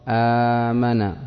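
آمن with the first vowel held long as aa-min; teacher slowly spells out آمن with its long madd; drawn-out long vowel. Shown as a demonstration. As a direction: stretch the opening aa of آمن slowly. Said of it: "وترسم في الإملاء هكذا".

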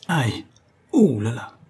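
pain. Acted. Sprains his ankle dropping down.

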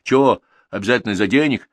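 hot-headed, indignant question; cocky, heated. A narrator's reading. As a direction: bristle like a young rooster. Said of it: "петушино загорячился Пашка-шофёр".

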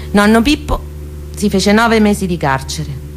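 normal talking voice

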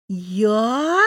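short outraged disbelief; can't believe it; stunned. A narrator's reading. Lera stunned at being blamed, incredulous.

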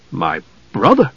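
low start leaping to a puzzled question; hushed, confused query; bewildered. A narrator's reading. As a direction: ask it in baffled disbelief. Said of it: "Caramon was bewildered".

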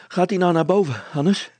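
loud, high, puffed-out question; panting. A narrator's reading. Father pedalling hard calls to Hannes, panting. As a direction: ask urgently, out of breath from pedalling. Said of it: "hijgde Brilstra".

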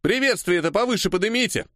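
high-pitched shouted instruction; calling out. A narrator's reading. Worker telling comrades to raise the banner, shouting. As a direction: call over the crowd. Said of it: "крикнул я".

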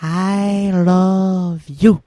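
very slow, drawn-out line; actress repeating. Retold or imitated. Actress repeating after Woody Allen, very slow and drawn out. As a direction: stretch it out like a dutiful actress. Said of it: "elle répète après lui".

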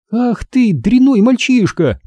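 put-on high-pitched girl's outrage; indignant scolding. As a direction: scold high, stretching the insult. Mindy scolds boy who tricked her. (возмущённый девчачий упрёк)

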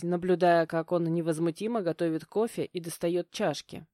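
usual speaking voice

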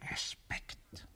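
very soft whispered reply; quiet whispered answer; whispering. Acted. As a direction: breathe the word back. Hushed reply.

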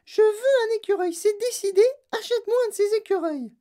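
put-on very high, loud child voice; shrieked demand; demanding spoilt girl. A narrator's reading. Spoilt girl ordering her mother to buy, shrieking.

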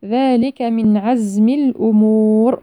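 slow chant with a long uu in الأمور; lengthened final vowel. Shown as a demonstration. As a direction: draw out the waw of الأمور before stopping. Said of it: "والمد عارض للسكون". (ترتيل مع مد الواو في الأمور عند الوقف)

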